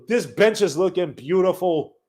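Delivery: ordinary delivery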